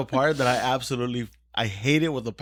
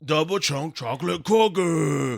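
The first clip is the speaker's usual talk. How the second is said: slow shouted announcement; 2 semitones higher, slower than usual. Pretend.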